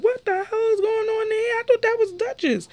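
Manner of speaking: high-pitched imitation of a startled friend; shocked Sky. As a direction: squeal it like you can't believe your eyes. Sky shocked at seeing Melody.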